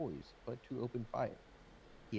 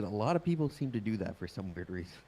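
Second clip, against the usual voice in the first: repeated louder for clarity; 7 dB louder than usual. Real, not acted. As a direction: say it again, louder and clearer. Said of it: "sorry, repeat?".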